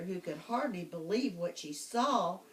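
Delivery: ordinary delivery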